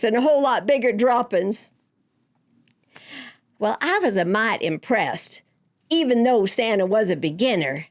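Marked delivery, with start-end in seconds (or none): none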